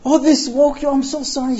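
high, loud flustered apology; startled apology. Retold or imitated. Wife sees her music woke him, startled apology. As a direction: gush an alarmed sorry.

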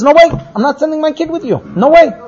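high, emphatic no way; father flatly refusing to send his youngest son; firm refusal. Acted. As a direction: slam the door on the request. Yaakov refuses to send Binyamin.

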